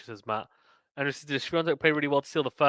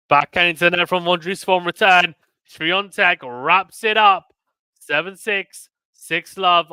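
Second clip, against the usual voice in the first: raised, louder match-winning call; live play-by-play; 5 semitones higher, 8 dB louder than usual. Real, not acted. Commentator calling Swiatek's win on match point, raised play-by-play.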